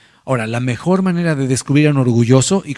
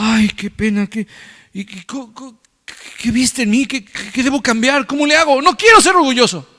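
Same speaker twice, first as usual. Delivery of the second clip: higher, anxious eager questions; mock contrite humble believer; humble worry; 8 semitones higher than usual. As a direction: plead to know what to change. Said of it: "el humilde dice".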